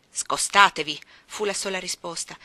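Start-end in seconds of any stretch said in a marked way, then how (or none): 0.1-1.0 loud, low single order; curt command. Acted. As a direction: brush the maids aside. Ordine secco della signora Reed. (ordine secco e forte)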